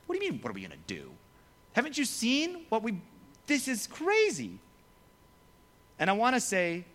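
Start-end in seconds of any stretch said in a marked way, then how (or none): 0.0-1.1 fast, low, softer retort; hurried mock disbelief, kept low; mock exasperated. Acts out exasperated reply. 1.7-4.6 high-pitched acted incredulity; mock disbelief. Acts out people in disbelief.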